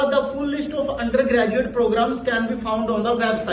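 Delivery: slow, even rhythm, words kept clear; steady rhythm, no rush; moderate rhythmic demo. Shown as a demonstration. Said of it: "sentence हमारा एक rhythm में होना चाहिए". (लय में, साफ़ और संतुलित गति)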